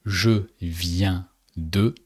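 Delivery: je viens de, slowly and clearly; slow French demo. Shown as a demonstration. Teacher modelling je viens de slowly for a learner. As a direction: spell out je viens de one word at a time. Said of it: "and then the verb in the infinitive".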